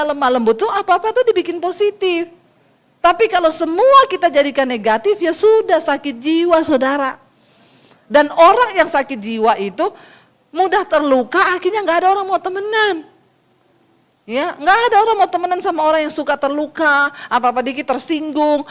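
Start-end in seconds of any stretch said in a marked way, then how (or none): none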